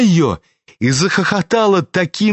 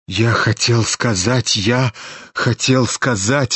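trembling; 5 semitones lower than usual. A narrator's reading. Князь в смятении оправдывается: тихо, ниже, дрожа. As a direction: stammer it out in fright. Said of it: "затрепетал князь".

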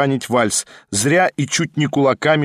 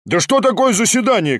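high, mildly shouted challenge; exclaimed shout; 9 semitones higher than usual. A narrator's reading. Lone voice challenges the whole meeting, crying out. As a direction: cry out the question in exasperation. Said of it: "крикнул голос".

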